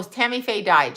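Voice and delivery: ordinary talk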